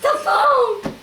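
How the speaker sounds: high, loud mock sobbing; too tearful to talk. Retelling her sobbed answer to friends, high wail. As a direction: wail it as if choked by happy tears. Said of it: "And I couldn't talk".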